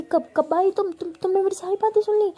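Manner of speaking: high, loud, flustered disbelief; embarrassed shout, realising she was overheard; startled outburst. Acted. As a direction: blurt it out in alarm, caught off guard. Bhavna caught talking unaware.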